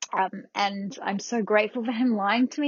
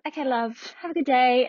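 higher, louder mock-cheerful farewell; cheery goodbye; 4 semitones higher, 5 dB louder than usual. Staff member leaving the patient, mock cheery. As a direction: imitate a breezy parting line.

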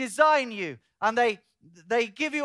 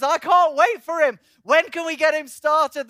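loud, high, acted excitement; eager impatience; 6 semitones higher, 9 dB louder than usual. The Spirit impatient to get him started, excited. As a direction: burst out as if unable to hold back.

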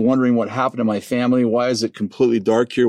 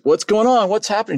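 panicked; 11 semitones higher than usual. Retold or imitated. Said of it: "I'm like".

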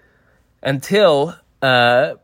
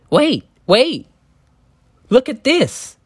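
put-on high, eager little-girl voice, much higher; little daughter voice; 11 semitones higher, slower than usual. Daughter tugs at Ethan Coen in the cinema, high and eager. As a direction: squeak it out like an excited kid.

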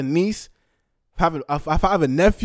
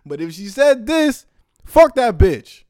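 angry outburst; 5 semitones higher, 8 dB louder, slower than usual. Real, not acted. Angry rant about a streamer.

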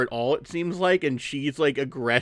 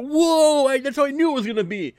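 high, loud, fast mock gasp of teenage boys; excited boys; 12 semitones higher, 5 dB louder than usual. Boys gawking at the girl, high excited mock exclaim. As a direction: gush it out like dazzled boys. Boys' excited reaction, retold.

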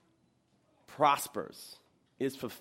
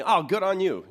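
high, loud exclamation of approval; loud, high-pitched pat on the back; acted hearty congratulation; 7 semitones higher, 8 dB louder than usual. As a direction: cheer someone on with a sudden lift in pitch. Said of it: "You'll do something".

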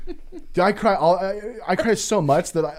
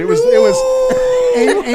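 mock wail; 7 dB louder, much slower than usual. Retelling crying breakdown.

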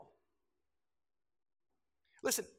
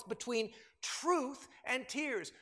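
slow refrain; 4 semitones higher, much slower than usual. Real, not acted. Sermon refrain.

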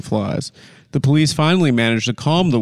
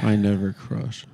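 mocking echo; 5 semitones lower, 6 dB quieter than usual.

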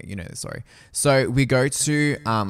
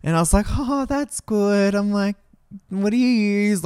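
high, politely awkward small talk; mildly strained show of interest; feigned interest; 10 semitones higher than usual. Retold or imitated. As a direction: put on a high, polite date voice.

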